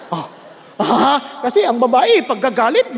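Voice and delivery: normal talking voice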